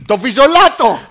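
loud, emphatic film request; loud comic enunciation. Pretend. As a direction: project it for a deaf phone system. Caller repeating loudly to phone system.

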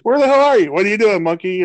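high, loud imitation of a friend shouting; mock yelling. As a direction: holler it like a buddy who lost you. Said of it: "you were talking like".

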